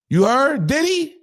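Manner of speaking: usual speaking voice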